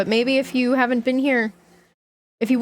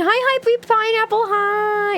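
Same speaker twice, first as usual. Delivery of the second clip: excited; 10 semitones higher, slower than usual. Real, not acted. High excited greeting.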